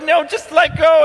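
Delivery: high, loud urgent plea; pleading shout. Retold or imitated. Parents pleading with their baby to release her hair, high loud urgency. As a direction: beg her to just let go. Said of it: "And we're like".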